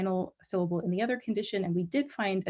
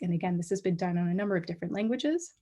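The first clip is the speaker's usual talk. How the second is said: normal talking voice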